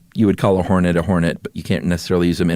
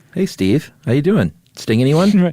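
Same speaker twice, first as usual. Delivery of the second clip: playful greeting; 8 semitones higher than usual. Pretend. Greeting a wasp named Steve.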